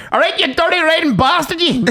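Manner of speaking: high-pitched yelled phone greeting; rowdy greeting. Retold or imitated. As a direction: holler it cheerfully as a foul-mouthed mate. Friend's rowdy greeting answering phone.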